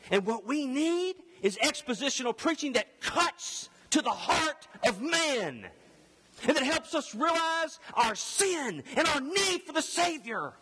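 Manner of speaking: high, punching pulpit delivery; forceful preaching. Acted. Preacher arguing for expositional preaching, high and forceful. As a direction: press it urgently on the congregation.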